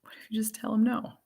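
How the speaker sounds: hushed, voiceless mock repeat; whisper. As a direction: whisper it as if it were unsayable. Repeats suggestion in mock horrified whisper.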